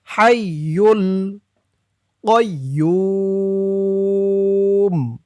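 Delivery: কয়্যুম drawn out very long in one held tone; three-alif elongation. Shown as a demonstration. Teacher shows students the long three-alif pull on কয়্যুম. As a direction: stretch কয়্যুম for three alifs. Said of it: "তিন আলিফ টান".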